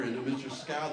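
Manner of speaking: ordinary talk, nothing marked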